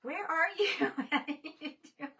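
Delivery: ordinary talk, nothing marked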